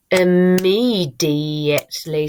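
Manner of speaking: "Immediately" stretched out loud and slow; teacher drawing out each syllable of Immediately. Shown as a demonstration. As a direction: sound out Immediately firmly, syllable by syllable. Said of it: "I've said it slowly".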